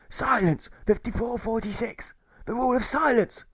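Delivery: gruff pirate jailer shouting silence; jailer barking, breathing heavily. Acted. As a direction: bark the rule like a strict old jailer. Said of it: "the jailer replies as he breathes in heavily".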